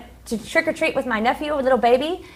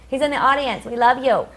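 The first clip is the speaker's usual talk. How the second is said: normal talking voice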